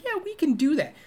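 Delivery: high-pitched indulgent sing-song; talking to a child. Retold or imitated. Woman agreeing to a milkshake, as if to a little kid. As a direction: imitate humoring a small child. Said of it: "She talks to him like a little kid".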